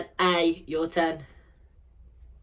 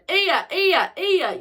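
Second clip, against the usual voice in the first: loud high shout; 12 semitones higher, 4 dB louder, slower than usual. Shown as a demonstration. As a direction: call the sound out loud. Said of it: "we'll shout for the last time".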